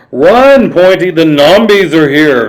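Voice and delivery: high, loud cry to run; panicked shout. Acted. Tiny warning Pointy of the Nombies: panicked shout.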